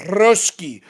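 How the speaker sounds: gibberish in a hammed-up Russian accent; sneering fake Russian accent; mock Russian accent. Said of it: "I'm not letting the Russian off the hook".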